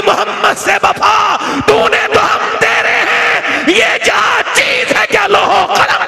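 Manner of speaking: slow, high, ringing chanted verse; booming political rally speech. Acted. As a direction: declaim the couplet like a rally cry. Recited verse climax. (जोशीली rally तक़रीर की गूंज)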